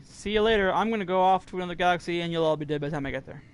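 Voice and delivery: loud mock farewell. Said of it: "So I said to my family".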